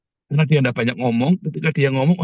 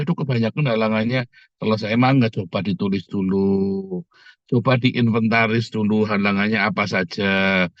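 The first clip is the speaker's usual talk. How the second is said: lower, slow grumble of a hesitant person; a lightly voiced doubter weighing obstacles; hesitant worrier; 6 semitones lower than usual. (meniru orang ragu, suara lebih rendah dan pelan)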